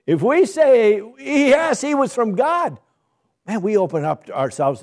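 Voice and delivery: high, loud mock reasoning, man; voicing the leaders. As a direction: play the scheming leaders talking it over.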